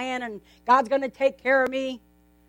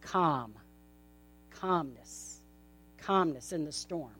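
calm and calmness said much lower and softer; hushed calm; 9 semitones lower, 12 dB quieter, slower than usual. Shown as a demonstration. Pastor hushing the room to picture calm. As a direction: let the voice settle into calm.

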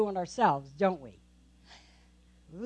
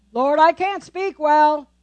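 Moses protesting; 4 semitones higher, 9 dB louder, slower than usual. Acted.

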